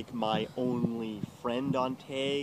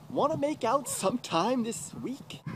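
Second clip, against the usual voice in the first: sleazy troll; 11 semitones higher than usual. Retold or imitated. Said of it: "he's like".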